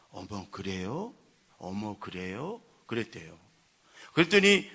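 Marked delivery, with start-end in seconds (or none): 0.1-2.6 soft, slow, low "oh, really?"; softened, drawn-out understanding reply; retold gentle sympathy. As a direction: imitate a patient wife hearing a complaint kindly. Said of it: "내가 아무리 화가 나도 ... 이러면 안 되겠다".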